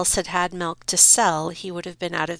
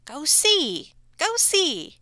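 much higher, urging squeal; frantic mother; 10 semitones higher, slower than usual. Acted. Mother pushes husband toward the Count, frantic. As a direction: shoo the husband forward. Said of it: "Buttercup's mother told him".

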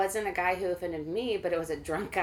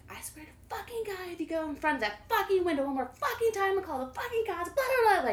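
mock furious tirade; 12 semitones higher, faster than usual. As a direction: tell off the drunk, pitched up. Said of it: "I lost my shit into him".

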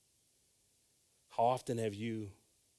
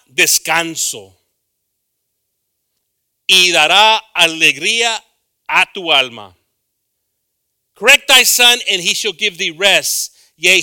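much louder and higher, proclaiming the verse; preaching scripture; 7 semitones higher, 18 dB louder than usual. Acted. Preacher proclaiming Proverbs to the congregation, booming. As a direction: thunder the proverb out to the church. Reads scripture at full force.

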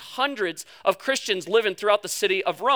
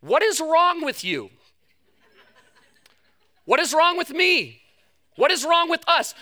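higher, pleading questions with pauses; rhetorical questions; 6 semitones higher than usual. Shown as a demonstration. Preacher asking the congregation, higher and urgent. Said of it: "I could ask it this way".

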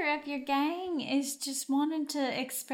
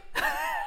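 high giggle that escapes; laugh breaking through; 13 semitones higher than usual. Real, not acted. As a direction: crack up mid-line. Breaks up mid-line.

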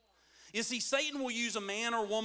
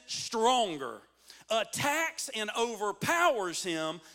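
raised, punchy pulpit delivery; forceful preaching; 4 semitones higher, 4 dB louder, slower than usual. Real, not acted. Preacher retelling the strong man parable, loud and building. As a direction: build in force toward the word UNTIL.

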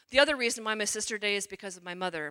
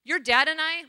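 higher, louder mother laying down the law; mother threatening her daughter, raised and insistent; stern ultimatum; 6 semitones higher, 7 dB louder than usual. Retold or imitated. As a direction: mom upping the ante, raised and firm. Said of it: "Mom's like".

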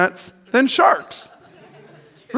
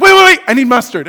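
mock urgent; 4 semitones higher, 6 dB louder than usual. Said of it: "you're like".

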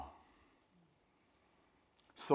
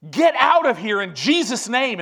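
retold rebuke, much higher and louder; commanding rebuke; 9 semitones higher, 10 dB louder than usual. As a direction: order the demon out with a shout. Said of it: "finally, Brother Hagin said".

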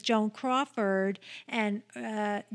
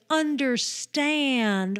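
"Understand" drawn out very slowly, a little higher and louder; odd emphasis; 4 semitones higher, 4 dB louder, much slower than usual. Retold or imitated. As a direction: stretch out "Understand" like a stagey schoolgirl. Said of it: "emphasizes weird words".

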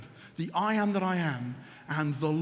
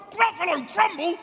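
high-pitched storybook alarm; alarmed Gruffalo; 8 semitones higher than usual. A narrator's reading. Gruffalo repeating the mouse's threat, high mock alarm. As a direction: echo it back high, in mock horror. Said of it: "the Gruffalo said".

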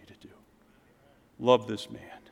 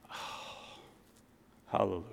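breathy relief; 7 semitones lower, 14 dB quieter than usual. Retold or imitated. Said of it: "It was like".

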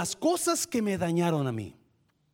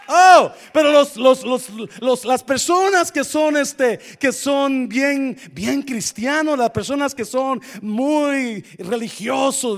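loud, raised preaching voice; fiery preaching; 6 semitones higher, 8 dB louder than usual. Real, not acted. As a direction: preach it out with fire. Preaching at full force.

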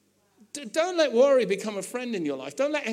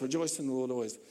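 scribe repeating; 7 semitones lower, 7 dB quieter, faster than usual. Retold or imitated. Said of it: "the guy goes".

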